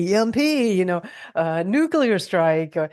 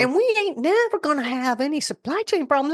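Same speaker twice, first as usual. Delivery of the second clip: put-on Texan accent; 11 semitones higher than usual. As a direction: play the Texan sister-in-law, pitched way up. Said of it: "I'm gonna try and do my best I can with her accent".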